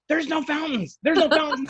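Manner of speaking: high, incredulous, breaking into a laugh; excited disbelief. Retold or imitated. Telling coworkers at the comm center, excited and laughing. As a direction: announce it like you can't believe it.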